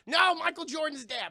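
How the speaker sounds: hammed-up shock at the collapse; mock panic. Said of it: "It's like".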